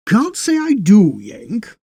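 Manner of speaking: high, loud start dropping on the last word; old railway man standing his ground; unyielding railway man. A narrator's reading. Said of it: "said the railway man, no pushover".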